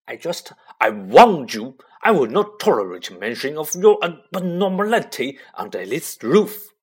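loud, raging warning; furious uncle. Acted. Uncle warns Harry about abnormality, loud rage. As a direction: lay down the law in a rage.

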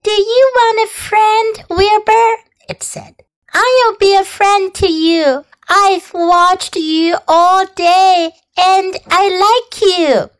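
high, gentle small voice; thin pleasant voice. Acted. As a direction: speak small and kindly. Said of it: "It sounded rather thin, but pleasant".

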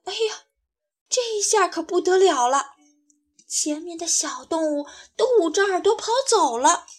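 ordinary delivery, nothing marked

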